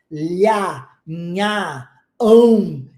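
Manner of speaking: lhá, nhá, ão said as sample sounds; sounds of Brazilian Portuguese. Shown as a demonstration. Teacher demonstrating sounds to students, lhá, nhá, ão. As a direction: show off lhá, nhá and ão as examples. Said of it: "Nós fazemos sons com a nossa língua".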